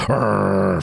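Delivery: drawn-out "arr" from the throat; mock dog growl. Shown as a demonstration. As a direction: do a human's fake dog growl. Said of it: "for a dog growl".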